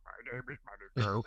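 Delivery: low, soft spirit guide doing a Fuzzy Bear. Retold or imitated. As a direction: lightly mimic a cuddly puppet bear announcing himself. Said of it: "it sounded just like Fuzzy Bear".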